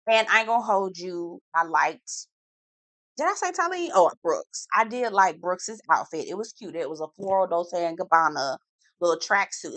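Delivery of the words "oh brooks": usual speaking voice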